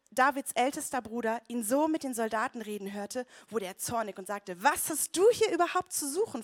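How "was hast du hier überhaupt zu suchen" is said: sharp, higher, louder rebuke; angry big brother snapping; snapping angrily. A narrator's reading. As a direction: snap at the little brother. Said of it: "fuhr er ihn an".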